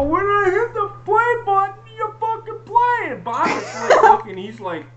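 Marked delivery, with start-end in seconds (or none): 0.0-3.1 high, loud, raging yell at the stream; furious yell. Retold or imitated. Yelling at the video that keeps stopping, furious. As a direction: scream it at the frozen video. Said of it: "I'm yelling vehemently".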